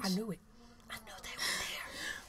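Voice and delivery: soft unvoiced whisper. Real, not acted. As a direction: breathe it in a whisper. Excited whisper backstage.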